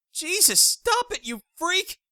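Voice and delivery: high, loud angry yell; furious yell. Acted. Jackie yelling at the biting neighbor, furious yell. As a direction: scream at him to stop. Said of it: "Jackie yelled".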